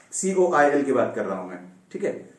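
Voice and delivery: ordinary talk, nothing marked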